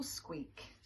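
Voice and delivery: ordinary delivery, nothing marked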